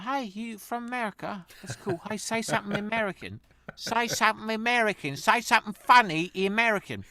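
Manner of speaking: put-on high, loud Essex English accent; mock Essex accent. Essex locals pestering the American newcomer, in a high, loud English accent. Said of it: "everybody talk like this".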